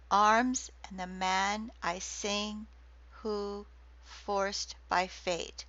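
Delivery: slow, each beat leaned on; slow metered reading. Shown as a demonstration. Lecturer showing Aeneid meter to class, slow metered reading. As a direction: read it slowly so the six feet are heard. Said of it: "that's six".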